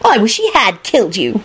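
mild plaintive wail; understated tearful complaint. A narrator's reading. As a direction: wail it with restraint. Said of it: "Rosie wailed".

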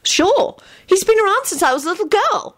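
very high, cheerful female character voice; high, delighted chatter; beaming. A narrator's reading. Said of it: "Rosie beamed".

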